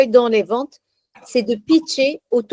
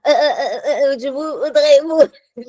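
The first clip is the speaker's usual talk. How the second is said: mock stammering; 4 semitones higher than usual. Shown as a demonstration. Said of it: "on bégayera moins".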